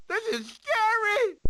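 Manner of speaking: high, crying whimper of fright; scared whimper. Acted. Sid on slippery ice, whimpering scared. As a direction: whine it out, terrified.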